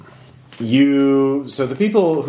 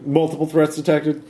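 high mechanical warning voice; robot alarm voice; 5 semitones higher than usual. Acted. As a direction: sound the alarm like a machine. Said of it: "but they're like".

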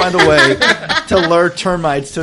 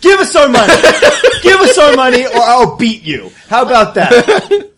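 loud, higher-pitched demand through laughter; mock-threaten the shopkeeper, raised voice; angry demand; 5 semitones higher, 5 dB louder than usual. Player threatening the merchant to get money back, angry.

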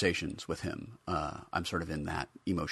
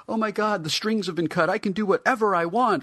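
high, loud giddy relief; 8 dB louder than usual. Retold or imitated. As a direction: gush like you're suddenly free. Giddy freedom after leaving acting.

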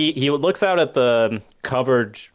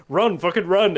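higher, louder urging cry; urgent yell; 8 semitones higher, 5 dB louder than usual. Retold or imitated. Viewers yelling at Tony on screen, a mock urgent shout. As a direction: shout at the screen for him to hurry.

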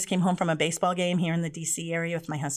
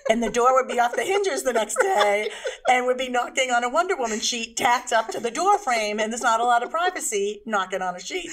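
laughing; 5 semitones higher, 5 dB louder than usual. Real, not acted. Laughing while telling.

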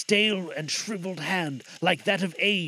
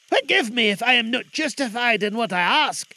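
put-on higher, louder anxious old man; Scrooge voice; 6 semitones higher, 5 dB louder than usual. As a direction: ask cautiously, pitched up. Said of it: "said Scrooge".

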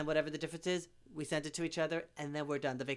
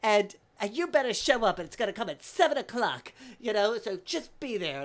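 put-on high, loud, pushy old man; flamboyant insistence; 7 semitones higher, 8 dB louder than usual. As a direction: press them grandly to show up, voice raised. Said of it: "so extravagant and flamboyant".

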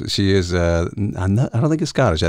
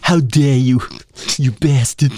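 mock outrage; 2 semitones higher, 4 dB louder than usual. Voicing Woody Allen's outrage.

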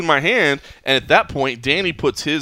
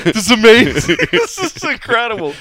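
imitating thrilled friend; 9 semitones higher, 6 dB louder than usual. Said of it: "that was literally Danny's like".

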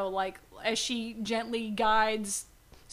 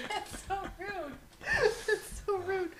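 high, weak voice through giggles; softly protesting, overcome with laughter; helpless laughter; 7 semitones higher, 6 dB quieter, slower than usual. Real, not acted. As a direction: squeak it out while laughing. Helpless giggles at being teased.

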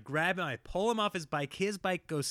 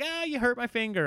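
imitating a whining little boy, higher; a mild mock whine, pitched up; dad mimics his son's complaint, higher; whiny kid complaint; 10 semitones higher than usual. Son complains after being grabbed.

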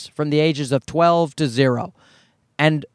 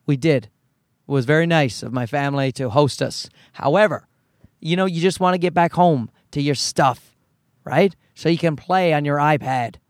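ordinary talk, nothing marked

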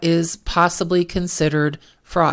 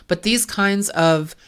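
normal talking voice

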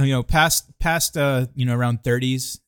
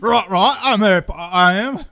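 mock London accent; 7 semitones higher, 6 dB louder than usual.

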